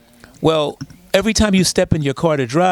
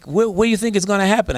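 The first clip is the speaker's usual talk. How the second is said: imitated caller, high and quick questioning; caller with attitude; 7 semitones higher, faster than usual. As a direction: press for an answer, raised and hurried. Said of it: "she calls up with attitude".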